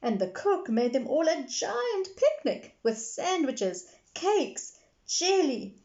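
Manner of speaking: put-on high eager little girl. A narrator's reading. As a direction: list treats like a small girl. Peppa listing foods.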